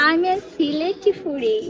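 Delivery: slow, drawn-out Sylheti dialect; slow Sylheti-accented reply; Sylheti girl. Acted. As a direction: lean into a Sylheti accent slowly. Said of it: "এ বলে সিলে-, সিলেটি ফুরি".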